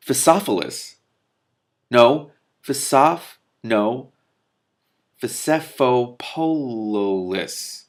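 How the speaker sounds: slow, effortful sounding out of "Phasephopololis"; struggling pronunciation. Real, not acted. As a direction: labour over each syllable of "Phasephopololis". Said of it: "I'll commit to that one".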